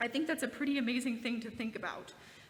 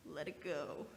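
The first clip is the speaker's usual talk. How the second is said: normal talking voice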